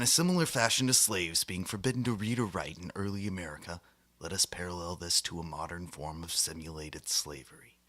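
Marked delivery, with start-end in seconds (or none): none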